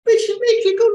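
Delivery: very high, loud strangled squeak; nervous squeak. Shown as a demonstration. Speaker showing what nerves do to the voice, squeaky. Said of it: "strange thing that nerves do to the voice".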